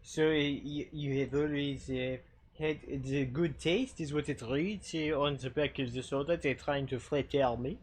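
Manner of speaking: playful mock French accent, ze for the; hammed-up French accent reading a soda can. Streamer reads the can for chat in a mock French accent. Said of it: "you have to speak with the, the French accent".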